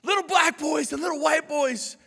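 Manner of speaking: ringing public proclamation; Martin Luther King Jr. Retold or imitated. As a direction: declaim it like a famous speech. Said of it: "We all know his dream speech".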